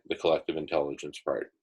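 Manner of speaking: usual speaking voice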